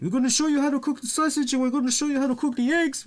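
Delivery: high, fast, repetitive mock wrestler voice; imitating La Parka. Mocking the wrestler repeating himself on his cooking show, in a high fast voice. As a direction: parrot the dumb line in a high, fast voice. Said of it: "he's speaking his own language".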